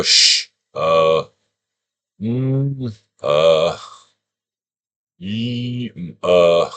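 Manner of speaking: unsure fragments of a word; halting sounding-out. Shown as a demonstration. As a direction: sound it out one broken piece at a time. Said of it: "you literally make that sound".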